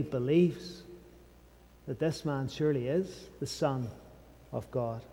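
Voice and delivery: soft and low, with weighty pauses; hushed reverence. Real, not acted. Preacher telling of the centurion coming to believe, hushed.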